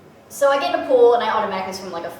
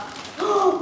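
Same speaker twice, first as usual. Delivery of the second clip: sharp, high intake of breath; excited gasp; 10 semitones higher than usual. Real, not acted. Unboxer pulls out the next item, excited gasp.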